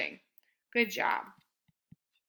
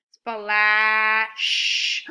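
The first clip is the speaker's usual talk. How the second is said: Splash said loud and stretched; emphatic demo; 7 dB louder than usual. Shown as a demonstration. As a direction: spell it out loud, slowly. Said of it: "That's correct".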